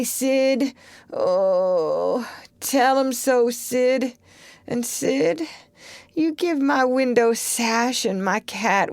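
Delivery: hammed-up tearful last wishes; slow, noble dying bequest; mock deathbed. Tom giving fake last wishes to Sid, mock tearful.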